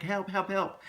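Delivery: half-voiced, high movie-style plea for rescue; mock cry for help. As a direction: act out flagging someone down. Flagging down a passing car for help.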